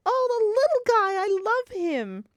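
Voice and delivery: high-pitched mock gushing; gushing adoration. Fan imitating Phoebe fawning over the man, gushing. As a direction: coo it like a smitten admirer.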